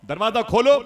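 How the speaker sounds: high, loud call to open up; loud demand. Acted. Grandfather at the door calls to be let in, loud and high.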